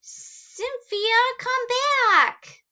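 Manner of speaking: high, loud bossy shout; demanding call. Acted. Emma calling the monster back, high and bossy. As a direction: demand it like a stubborn kid. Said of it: "Emma demanded".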